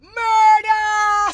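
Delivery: high wailing shout of murder; crying murder. Real, not acted. Ranting woman cries murder at the world. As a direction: wail it out to the whole street.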